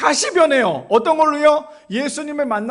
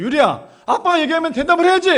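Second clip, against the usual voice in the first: retold scolding yell; 5 semitones higher, 5 dB louder than usual. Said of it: "팍 하고 소리를 질렀어요".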